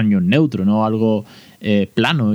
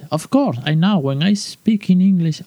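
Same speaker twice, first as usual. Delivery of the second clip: higher, slow put-on posh English accent; mock posh English accent; 8 semitones higher, slower than usual. Mocking parents who fret over accents, in a slow posh English accent. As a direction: lampoon a snooty English speaker in a posh English accent.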